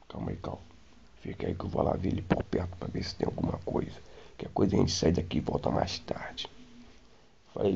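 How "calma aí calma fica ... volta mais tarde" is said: retold hiding whisper; hushed whisper. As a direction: whisper so the monster won't hear. Hiding, Dudu scouts the monster.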